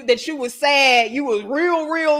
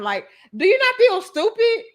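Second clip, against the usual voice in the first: high, louder disbelief; rising up in disbelief at the idea; incredulous; 9 semitones higher, 3 dB louder than usual. Real, not acted. To viewers, mocking people who post crying selfies, incredulous.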